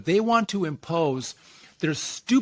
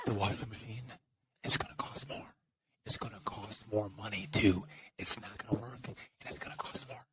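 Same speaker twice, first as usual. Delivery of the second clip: Joe Biden; 9 semitones lower, 14 dB quieter than usual. Acted. Host channels the president on washing machines, mock whisper. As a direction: whisper it low, phrase by phrase. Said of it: "I'm whispering like Joe does".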